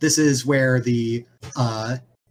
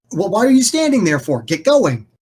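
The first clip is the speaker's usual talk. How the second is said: impatient boss; 8 semitones higher, 4 dB louder than usual. Acted. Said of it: "And he's like".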